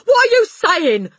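high, loud outburst; alarmed cry. A narrator's reading. Husband to wife, alarmed cry. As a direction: cry out aghast. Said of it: "the husband cried".

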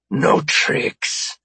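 rough, rasping alien voice; hoarse threat from an alien captor; alien's warning. Acted. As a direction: warn him as a menacing alien. Alien warns guard disarming.

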